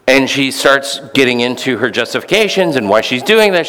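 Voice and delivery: ordinary talk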